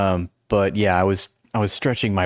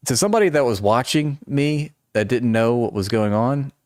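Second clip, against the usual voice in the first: ordinary talk, nothing marked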